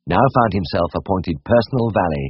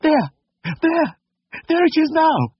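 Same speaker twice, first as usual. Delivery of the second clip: very high falsetto alarm; horrified lady. A narrator's reading. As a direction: point it out in dread, squeaking high. Horrified lady points at pig.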